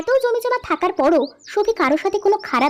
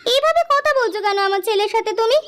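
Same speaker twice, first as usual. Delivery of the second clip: higher-pitched, indignant wife; protesting mother; 6 semitones higher than usual. Acted. Wife objects to husband's tone with son, protesting. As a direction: jump in to defend your son, pitched up.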